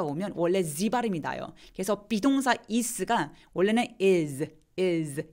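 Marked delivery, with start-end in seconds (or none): 4.0-5.3 "is" ending in a buzzing z; z sound. Shown as a demonstration. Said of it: "Z 발음이 나잖아요".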